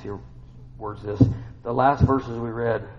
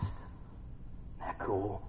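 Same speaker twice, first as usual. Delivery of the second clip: awed whisper; 3 semitones lower, 12 dB quieter, much slower than usual. Real, not acted. Awed soft aside.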